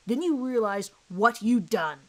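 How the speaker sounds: ordinary delivery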